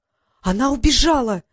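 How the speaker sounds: loud, high alarmed gasp; Дейла ахает громко и высоко. A narrator's reading. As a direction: blurt it out, shocked she ran. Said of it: "ахнула Дейла".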